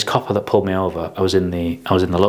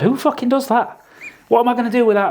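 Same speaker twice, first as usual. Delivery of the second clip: much higher, incredulous; excited disbelief. Retold or imitated. Recalling the fast company car, high excited disbelief. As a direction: swept up in the thrill.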